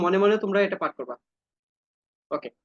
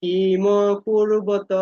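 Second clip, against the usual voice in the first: কিম পূর্বত drawn out and louder; slow emphatic; 2 semitones higher, 5 dB louder, much slower than usual. Shown as a demonstration. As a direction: stretch and stress পূর্বত. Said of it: "এই জন্য আমরা কি বলি?". (কিম পূর্বত জোর দিয়ে টেনে)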